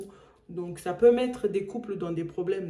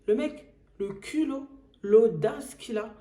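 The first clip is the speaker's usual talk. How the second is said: indignant; 4 semitones higher, 7 dB louder than usual. Real, not acted. Outraged at the caller's nerve.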